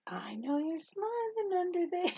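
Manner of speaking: high, slow teasing coo; playful coaxing. Retold or imitated. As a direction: coax a smile from a tearful child. Coaxing a crying child to smile.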